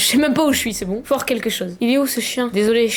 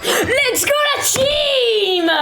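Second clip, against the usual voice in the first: long, high drawn-out squeal; victory scream; 8 semitones higher, much slower than usual. Real, not acted. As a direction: scream it out in triumph, stretching words. Celebrates a win. (hurlement de victoire aigu et étiré)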